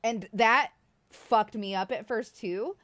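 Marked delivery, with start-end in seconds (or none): none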